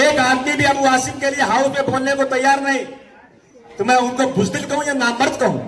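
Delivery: high-pitched, sustained political declamation; rally oratory. Real, not acted. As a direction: proclaim it to the whole crowd. (ऊंची आवाज़ में जोशीला भाषण)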